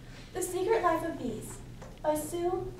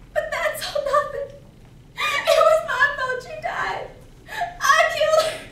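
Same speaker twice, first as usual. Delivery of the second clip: high, loud, gasping through tears; sobbing confession; 9 semitones higher, 6 dB louder than usual. Acted. Girl confesses to beekeeper she killed her mother, sobbing. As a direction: break down more with each admission, voice climbing.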